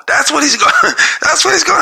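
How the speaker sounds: breaking into a laugh mid-sentence. Real, not acted. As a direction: voice jumps high as he cracks up. Mocking prediction, breaks into laugh.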